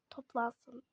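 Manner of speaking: ordinary talk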